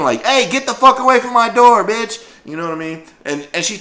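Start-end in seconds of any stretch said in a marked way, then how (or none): 0.3-2.2 high, loud screaming; screaming at mom. Retold or imitated. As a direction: scream it at her mother. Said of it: "she was just screaming".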